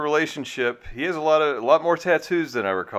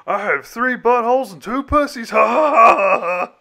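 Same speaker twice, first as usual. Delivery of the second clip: very high comedian voice breaking into loud forced laughter; Amy Schumer parody; 13 semitones higher, 7 dB louder than usual. Acted. Host parodying a comedian's monologue, hammed-up high squeal. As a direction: squeal the punchline, then blast a fake laugh. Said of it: "Did the monologue have something like".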